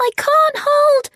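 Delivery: very high-pitched desperate cry; desperate shout. A narrator's reading. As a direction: shout while losing your grip. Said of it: "she shouted".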